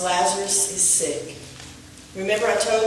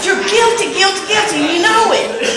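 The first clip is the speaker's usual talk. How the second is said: high, loud playful guilty guilty; teasing accusation; 9 semitones higher, 4 dB louder than usual. Real, not acted. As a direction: point the finger with a grin. Preacher teasingly accuses congregation.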